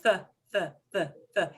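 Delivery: the said with a soft th, like English the; tongue between teeth for the; soft th sound. Shown as a demonstration. Teacher showing learners the soft th in the. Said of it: "it will really sound like the".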